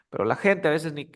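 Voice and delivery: ordinary talk, nothing marked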